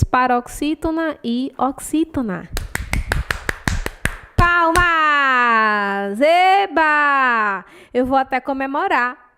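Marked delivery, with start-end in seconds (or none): 4.4-7.6 long drawn-out mock cheering; playful cheer. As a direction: stretch each word like a crowd cheer. Cheering for the right answer. (comemoração com vogais bem alongadas)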